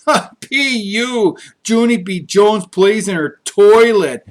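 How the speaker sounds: slow, high, loud jeering; a mean boy's loud slow taunt; mocking holler. A narrator's reading. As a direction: taunt her loudly so everyone hears. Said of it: "he hollered".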